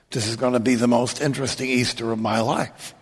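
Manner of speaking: usual speaking voice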